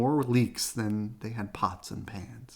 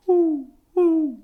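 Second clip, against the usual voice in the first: owl hoots; 10 dB louder than usual. Acted. Owl noises.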